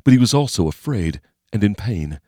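ordinary delivery, nothing marked

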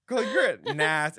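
high squeal through laughter; cracking up mid-word; laughing. Real, not acted. Laughing at gross food confession.

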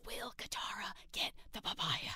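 hushed mock-secret whisper; a conspiratorial whisper over the papaya question. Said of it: "whispered punchline".

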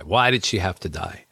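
usual speaking voice

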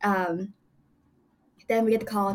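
ordinary delivery

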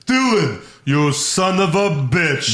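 hearty slow movie greeting; Predator movie quote. Retold or imitated. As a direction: deliver it like the action hero. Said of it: "the epic handshake from Predator".

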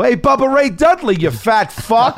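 high, loud jeer, slowing and breaking into a laugh; heckling yell. Retold or imitated. Fan yelling an insult at the wrestler across the lot, laughing jeer. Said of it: "Or you go".

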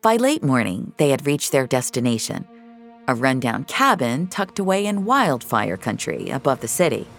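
usual speaking voice

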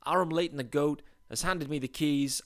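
usual speaking voice

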